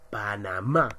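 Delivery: Panamá with the last "má" punched; stress on final syllable. Shown as a demonstration. Teacher showing pupils where the accent falls, stressed last syllable in Panamá. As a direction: lean hard on the "má" in Panamá. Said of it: "la mayor fuerza de voz está en ma".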